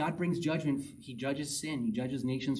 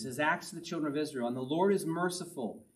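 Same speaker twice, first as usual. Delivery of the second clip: ordinary talk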